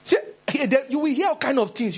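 high excited retelling; excited storytelling. Retold or imitated. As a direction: voice jumps high and eager. Telling children's scare story excitedly.

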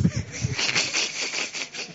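high breathless mock laugh; mocking cackle. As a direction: laugh at her pride. Mocking the proud sister.

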